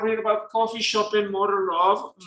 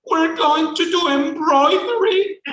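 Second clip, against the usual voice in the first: put-on thrilled child; 11 semitones higher, 6 dB louder than usual. Boy thrilled about sewing kit.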